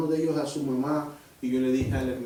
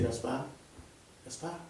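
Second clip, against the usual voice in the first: hushed, distant voice calling a name; soft calling voice; 12 dB quieter, slower than usual. Retold or imitated. The Spirit of God calling Gaspar in the shower, hushed call. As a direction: call him gently, as if from nowhere.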